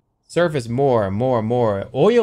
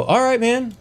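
a hint of a bored celebrity; lightly voiced actor; 10 semitones higher, slower than usual. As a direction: shrug it off coolly. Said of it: "he'd go".

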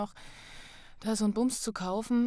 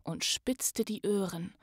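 lively rhyming read-aloud, rising to loud on the joyful parts; story narration; 9 dB louder, slower than usual. Acted. Podcast host reads the Grinch story to listeners, lively storytelling. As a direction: tell the Grinch tale with swelling excitement.

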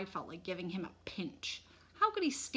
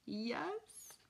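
soft, high, rising question; weak, small-voiced mother; 4 semitones higher, 7 dB quieter than usual. A narrator's reading. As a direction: ask it faintly, in disbelief. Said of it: "Mom asked weakly".

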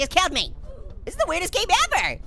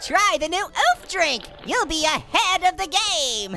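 slow, stretched-out commercial pitch; mock ad announcer; much slower than usual. Pitching a new drink to viewers, mock announcer. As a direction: sell it like a cheesy advert.

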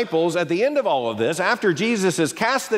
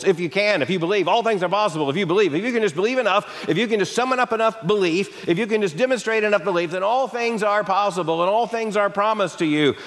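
fast, piled-up promises, pitched higher; name-it-claim-it urging; 4 semitones higher, faster than usual. Retold or imitated. Prosperity preacher urging people to believe enough, fast and insistent. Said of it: "name it and claim it impulse".

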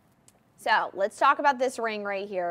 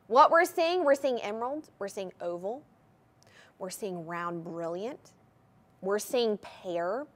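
ordinary delivery

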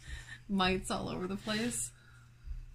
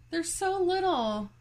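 cooing at bugs; 9 semitones higher, 4 dB louder, slower than usual. Real, not acted. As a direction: gush over them like puppies. Cooing at tiny mites.

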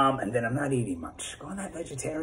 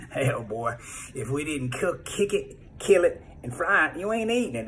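high, hammed-up Southern granny voice; mock Georgia granny laying down the rule; Southern grandma accent; 4 semitones higher than usual. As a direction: play a scolding Southern grandma, pitched high. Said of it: "a rule Grandma had".

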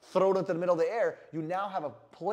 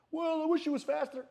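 higher customer voice; 9 semitones higher than usual. Retold or imitated. Customer voiced in sales demo.

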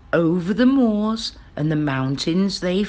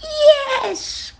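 high, loud witch's "yes"; delighted story witch calling out high; witch's cry; 4 dB louder than usual. A narrator's reading. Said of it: "cried the witch".

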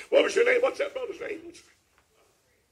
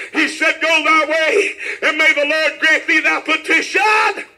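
full-force scriptural declaration; proclaiming preacher; 5 semitones higher, 10 dB louder, slower than usual. Real, not acted. Preacher quoting the priest's blessing, full-force preaching. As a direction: proclaim the blessing out to the whole church.

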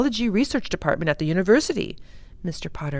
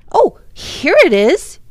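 high, loud cry of discovery; loud burst of excitement; sudden excited cry; 11 semitones higher, 13 dB louder than usual. A narrator's reading. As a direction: cry out as you spot it. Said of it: "he cried suddenly".

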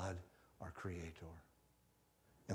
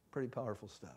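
ordinary talk, nothing marked

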